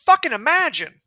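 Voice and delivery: loud, high exasperated shout; outraged shout; furious shout. Real, not acted. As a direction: yell it in disbelief. Climax of the rant.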